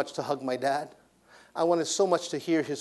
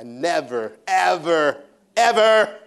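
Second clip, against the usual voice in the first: never, ever, ever rising louder each time; building emphasis; 5 semitones higher, 9 dB louder, much slower than usual. Real, not acted. Preacher insisting he never talked back, building louder. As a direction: stack them up, ever louder and higher. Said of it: "building emphasis on never".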